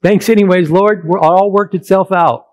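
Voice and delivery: mock casual thanks, voice up and loud; careless attitude. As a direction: toss off a casual thanks to God. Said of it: "you know what my attitude was".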